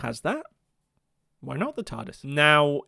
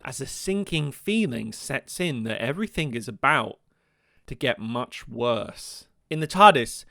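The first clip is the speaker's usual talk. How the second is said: normal talking voice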